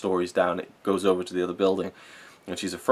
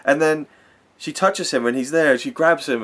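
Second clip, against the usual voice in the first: high, loud, choked-up retelling; real emotion pushing the voice right up; getting emotional; 8 semitones higher, 6 dB louder than usual. Real, not acted. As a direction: overcome while telling the story. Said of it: "I'm getting a bit emotional".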